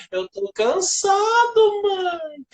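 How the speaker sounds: high, slow drawn-out whine; high tired whine. Retold or imitated. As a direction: whine it like a worn-out kid. Kid whining to mother.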